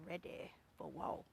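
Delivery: slow, whispered war vow. Acted. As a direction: breathe it like a threat. Dramatic declaration, whispered.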